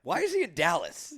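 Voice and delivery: loud, high incredulous question; can't believe the guy's in Dallas; sudden amused disbelief; surprised question. Real, not acted. Disbelief at the German's story.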